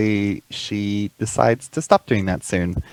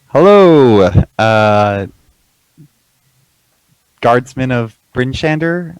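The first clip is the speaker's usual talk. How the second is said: loud call up to the towers; 6 dB louder, slower than usual. Acted. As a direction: call up to the guards. Calling up to the gate guards.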